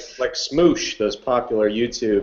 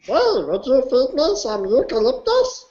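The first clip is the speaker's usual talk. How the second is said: koala voice. Acted. Stays in koala voice.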